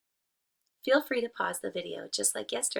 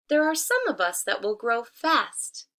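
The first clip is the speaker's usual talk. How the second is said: higher, louder sly tree voice; 3 semitones higher, 6 dB louder than usual. Acted. Wolf posing as a tree, sly and coaxing. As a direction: coax her, slyly. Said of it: "said the tree".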